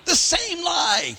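high slow drawn-out shout; exaggerated high cry; mock shout. Climax of retold story.

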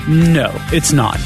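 ordinary delivery

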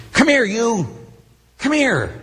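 high, loving come-here; tender father call. Retold or imitated. God as loving father calling his child, tender and high. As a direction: call a child into your arms.

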